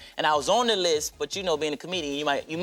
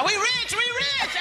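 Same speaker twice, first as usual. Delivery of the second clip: high, slow mock cheering; family cheering; much slower than usual. Family celebrating his money in high, slow glee. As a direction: drag out a squealing family chant. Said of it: "I was like".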